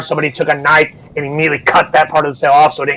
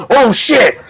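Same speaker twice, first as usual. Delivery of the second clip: startled; 9 semitones higher, 3 dB louder than usual. Acted. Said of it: "and it's like".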